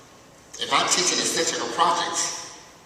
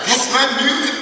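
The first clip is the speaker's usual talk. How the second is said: mock excited, much higher and louder; 12 semitones higher, 8 dB louder than usual. Former student telling the manager, excited. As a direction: proudly blurt it out. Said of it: "she's excited".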